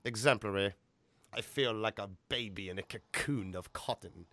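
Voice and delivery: Tim Curry impression. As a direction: savour each yes like a theatrical Brit. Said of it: "that one was close".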